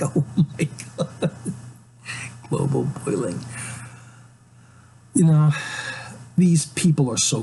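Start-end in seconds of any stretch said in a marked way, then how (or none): 0.0-3.4 whispered oh my god breaking into laughter; laughing mockery. Real, not acted. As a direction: scoff at the phrase through a laugh. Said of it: "It's like".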